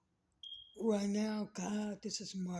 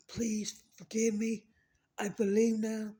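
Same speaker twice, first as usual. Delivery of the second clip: loud, slow desperate plea; pleading for mercy; 3 semitones higher, 11 dB louder, slower than usual. Retold or imitated. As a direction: beg forgiveness at full voice. Sinner begging for mercy.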